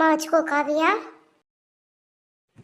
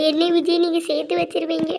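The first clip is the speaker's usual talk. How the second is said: ordinary talk, nothing marked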